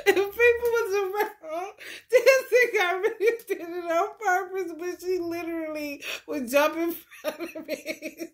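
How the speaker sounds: high voice, laughing through the words; helpless laughter. Real, not acted. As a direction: struggle to talk through real laughter. Cracks up telling cat story.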